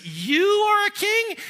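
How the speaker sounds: high, loud, incredulous question; mocking ridicule. Pilate mocking Jesus' claim to kingship, mocking ridicule. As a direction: scoff with a slow, soaring YOU. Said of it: "definite tone of ridicule and mockery".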